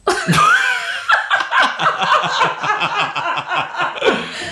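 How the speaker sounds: sudden high burst of laughter. Real, not acted. Laughs at remembered name.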